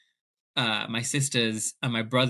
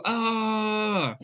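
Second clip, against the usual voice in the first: high excited squeal of joy; a high, joyful squeal; 9 semitones higher than usual. Retold or imitated. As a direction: let out a happy cry. Dream come true.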